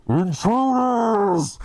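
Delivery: high, drawn-out shout of alarm; muffled guard shout. Acted. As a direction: bellow a warning from inside a helmet. Enemy in bucket helm shouts.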